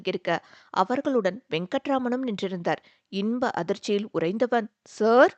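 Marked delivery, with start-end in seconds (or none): 4.9-5.3 a loud burst of happy surprise; joyful surprised cry. A narrator's reading. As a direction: call out in sudden delight. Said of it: "என்ற விழிப்புடன்". (இன்ப அதிர்ச்சியில் உரத்த அழைப்பு)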